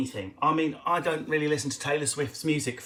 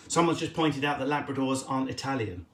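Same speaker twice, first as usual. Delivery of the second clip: normal talking voice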